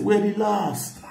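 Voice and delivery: high, loud shouted "relax". Retold or imitated. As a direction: shout "relax" over the noise. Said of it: "He dey shout".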